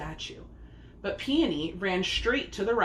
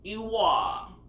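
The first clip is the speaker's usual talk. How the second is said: croaking frog. A narrator's reading. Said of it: "croaked the giant frog".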